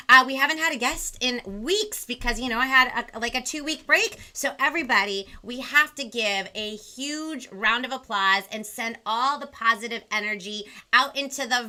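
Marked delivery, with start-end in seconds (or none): none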